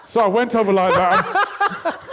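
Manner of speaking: laughing through the words; giggling as he tells it; words pushed out through laughter. Real, not acted. Laughing while retelling prank.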